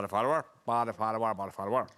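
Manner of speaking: rattled off fast in a Northern Irish accent; a quick lightly done Northern Irish accent. Shown as a demonstration. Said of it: "a Northern Irish accent".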